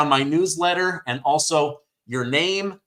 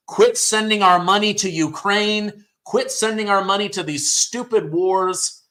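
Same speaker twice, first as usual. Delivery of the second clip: indignant demand; 6 semitones higher than usual. Real, not acted. Ranting about Ukraine war funding.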